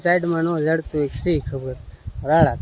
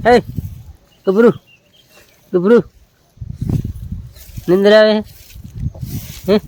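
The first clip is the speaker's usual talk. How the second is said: loud, higher sing-song call; calling the calf; 5 semitones higher, 5 dB louder than usual. Real, not acted. Vlogger calling his tethered calf, loud coaxing call.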